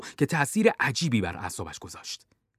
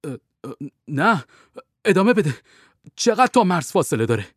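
raised, louder excited urging; 4 semitones higher, 5 dB louder than usual. A narrator's reading. Bond to Sender asking the distance to the border, excited. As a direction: press on urgently after a hesitant start. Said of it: "باند هیجان زده گفت".

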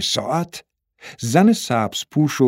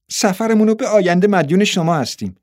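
lightly voiced high young girl; girlish thanks, pitched high; grateful girl; 6 semitones higher than usual. A narrator's reading. Niece thanks the man for the tickets, gratefully.

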